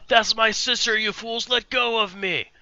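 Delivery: high, frantic pleading soldier. Acted. Soldier held back by comrades, high frantic shouting. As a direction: fight off the men holding you. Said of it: "he goes".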